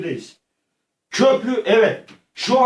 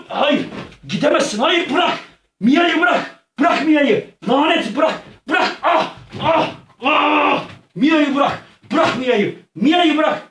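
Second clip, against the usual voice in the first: desperate shouting; 6 semitones higher, slower than usual. Pretend. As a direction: scream at the attacker to let her go. Fighting Keser to save Mia.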